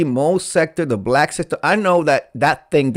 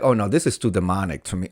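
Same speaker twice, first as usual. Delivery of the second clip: mock recoil; 6 semitones lower, faster than usual. Host recalling his reaction to the exorcism, quick and low. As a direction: back off in lightly played horror. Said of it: "I was like".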